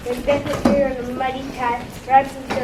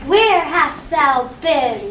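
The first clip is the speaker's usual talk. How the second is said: loud, slow witch voice; witch's cry; 3 semitones higher, 9 dB louder, much slower than usual. Acted. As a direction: call out like a crone on the heath. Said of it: "The first witch cried".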